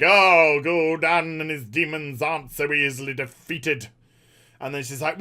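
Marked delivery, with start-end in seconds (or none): 0.0-3.9 lightly voiced wizard, lower pitch; Khadgar. Wizard warning about demons, lightly put-on voice. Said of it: "Khadgar's like".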